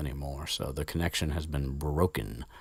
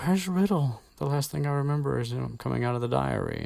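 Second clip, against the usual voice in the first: Ginny confused; 8 semitones higher than usual. Retold or imitated. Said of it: "she's like".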